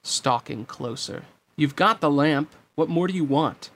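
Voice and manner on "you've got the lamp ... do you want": louder, higher challenge; demanding. Acted. Aladdin confronts Jafar who holds the lamp, demanding. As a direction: press the enemy for an answer. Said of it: "Aladdin demanded".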